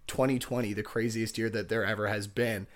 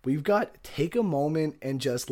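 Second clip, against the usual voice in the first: usual speaking voice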